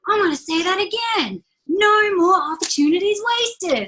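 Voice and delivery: imitating motivational speaker. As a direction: ham up the big motivational speaker line. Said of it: "big dark man in it that's like".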